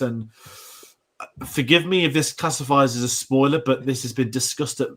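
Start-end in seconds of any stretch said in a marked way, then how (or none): none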